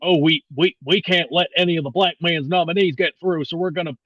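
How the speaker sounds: mock Mitch McConnell with a Southern accent. Host mocks Mitch McConnell's stance on nominees, Southern accent. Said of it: "I'm not gonna do that Southern accent".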